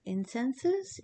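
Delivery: unsure rising "Incenses?"; doubting question. Shown as a demonstration.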